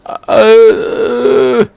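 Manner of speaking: a high, helpless little grunt; imitating a clueless teen's noise; mocking a whiny teen's grunt; mock Shinji grunt. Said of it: "see you just go".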